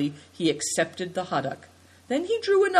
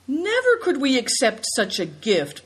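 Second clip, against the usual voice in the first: higher, polite protest; 8 semitones higher, 3 dB louder than usual. A narrator's reading. Guest protests against the host's silver gift. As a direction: refuse the gift with humble insistence. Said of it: "protested Good Fortune".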